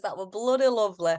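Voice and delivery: a light attempt at Yorkshire accent. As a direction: try a broad Yorkshire accent. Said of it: "Is that your best Yorkshire accent?".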